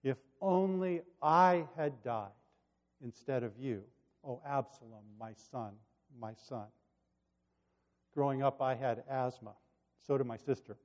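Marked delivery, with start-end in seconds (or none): 0.0-6.7 hushed, reflective quote of a bereaved father. Retold or imitated. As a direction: let the grieving words sink away. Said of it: "His words cut right to the heart".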